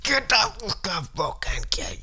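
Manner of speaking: high bark fading to a whisper; imitating a cussing neighbor. Neighbor cussing at the men, a high bark then whisper. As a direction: snarl it then drop away.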